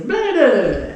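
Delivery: put-on high, loud kid gibberish; kid babbling. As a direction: babble like a small boy. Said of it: "he goes".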